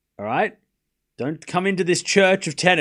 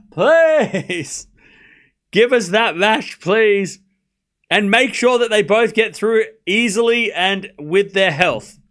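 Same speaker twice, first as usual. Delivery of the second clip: high, insistent begging; pleading prayer; 4 semitones higher, 4 dB louder, slower than usual. Pretend. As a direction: implore the tennis gods, voice raised. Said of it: "I'm praying".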